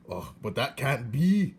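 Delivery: a hint of a gruff old uncle; lightly voiced old peasant; mild grumbling uncle voice; Uncle's voice. A narrator's reading.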